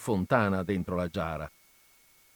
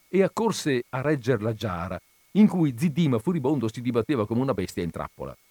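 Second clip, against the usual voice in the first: ordinary delivery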